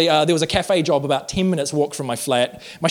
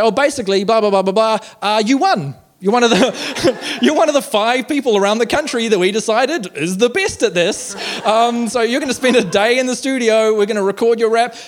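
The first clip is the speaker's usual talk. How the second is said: higher-pitched prize announcer, imitated; retold upbeat phone caller, pitched up; excited caller; 7 semitones higher, 4 dB louder than usual. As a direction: gush the good news like a campaign rep. Voices caller announcing the win.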